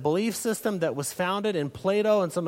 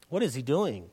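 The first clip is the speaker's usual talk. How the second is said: ordinary talk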